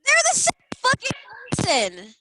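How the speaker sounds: high, loud exasperated insistence; emphatic outburst. Real, not acted. Emphatic outburst about two cases.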